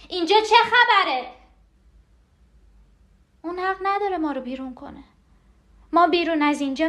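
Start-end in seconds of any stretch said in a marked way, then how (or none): 0.1-1.3 high, frantic outcry; panicked cry. Acted. Repeats the demand, escalating.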